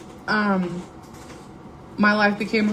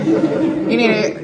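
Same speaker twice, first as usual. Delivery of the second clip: louder, drawn-out mock insistence; hammed-up doorstep recruiting; pushy evangelist; 3 semitones higher, 5 dB louder, much slower than usual. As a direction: play the evangelist, stretching it slowly for emphasis. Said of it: "evangelist knocking on people's door".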